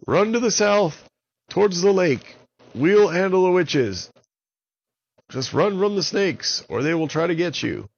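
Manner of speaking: high, loud urgent call; yelling. Acted. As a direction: shout the warning across. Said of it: "yelled to Petey and his crewmates".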